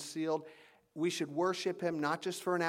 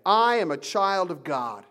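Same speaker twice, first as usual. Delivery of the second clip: confident declaration; 2 semitones higher, 6 dB louder than usual. Shown as a demonstration. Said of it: "say this to ourselves every day".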